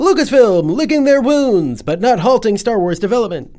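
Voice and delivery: put-on high, loud old newsreel announcer; fifties reporter voice. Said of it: "Incredibles style fifties reporter voice".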